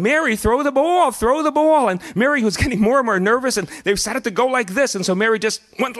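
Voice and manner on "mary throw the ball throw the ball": put-on high-pitched excited yell of little girls; kids yelling. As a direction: shout it up high like an impatient child. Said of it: "they kept yelling".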